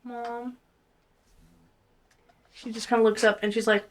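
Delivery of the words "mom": small, soft plea to mom; timid, hushed appeal; pleading daughter. Acted. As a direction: ask softly with puppy-dog eyes. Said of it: "with the biggest puppy dog eyes".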